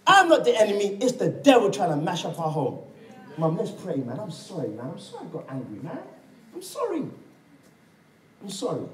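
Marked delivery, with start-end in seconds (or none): 3.4-8.9 mock soft apology. Voiced apology to mother.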